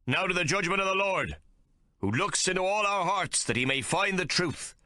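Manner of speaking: a zealot captain condemning Hawke; thundering captain. A narrator's reading. Captain calls on the Lord.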